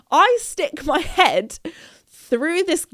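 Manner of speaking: ordinary talk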